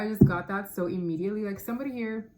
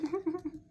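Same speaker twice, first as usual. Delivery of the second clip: short high laugh; 11 semitones higher, 3 dB quieter than usual. Real, not acted. Laughing at a karmic reading.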